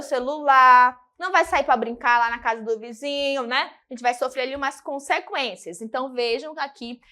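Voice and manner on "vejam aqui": ordinary talk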